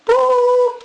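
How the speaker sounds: long high beep; censor bleep. Retold or imitated.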